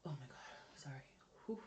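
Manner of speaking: breathless whispered gasp; gasping, squeezed by a tight corset; gasping for air. Real, not acted. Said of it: "body going with every breath I take".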